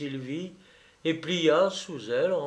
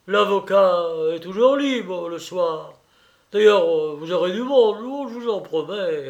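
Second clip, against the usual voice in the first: put-on higher, louder blustering old soldier; animated colonel; 4 semitones higher, 6 dB louder than usual. A narrator's reading. The colonel promises Sylvie company in the evenings, animated bluster. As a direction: protest and promise with gusto.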